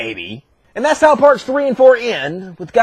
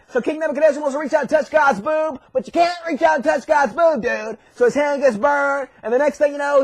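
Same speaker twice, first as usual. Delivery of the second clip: ordinary delivery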